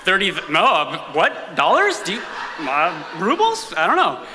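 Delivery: acted-out bewildered questioning; baffled. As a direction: stammer in puzzled disbelief, pitch jumping up. Both act baffled at the question.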